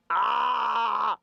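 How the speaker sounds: long, high mock cry; mock wail. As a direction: cry out in exaggerated dismay. Mock cry after comparing dress sizes.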